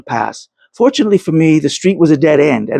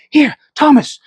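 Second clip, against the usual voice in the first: put-on much higher young woman's hushed urgency; girl's urgent call; 11 semitones higher than usual. A narrator's reading. A girl guides Thomas to a window, urgent. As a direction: call him over quickly as a young girl. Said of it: "heard a female voice say".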